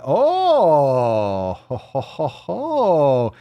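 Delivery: long loud "oh", then ho-ho-ho; drawn-out swooping whoops of anticipation; hyped-up hollering. Acted. Hype build-up to shit post segment.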